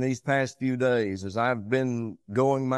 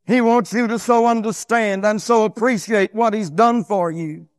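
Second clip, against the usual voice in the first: loud, high pulpit delivery; raised preacher's voice, driving each phrase; full-force preaching; 9 semitones higher, 10 dB louder than usual. Real, not acted. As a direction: preach it out strongly, high and emphatic.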